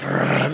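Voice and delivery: rolled growling noise; imitated growl. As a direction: growl like guys psyching themselves up. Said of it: "making as much growling noises as we can".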